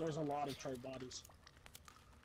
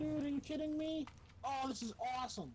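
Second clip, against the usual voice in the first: excited; 9 semitones higher than usual. Real, not acted. Sees thunderstorm, thrilled.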